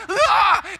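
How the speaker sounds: loud, high mock shriek of fright; retold terrified scream. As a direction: scream as if scared out of your mind. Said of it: "scared out of my mind".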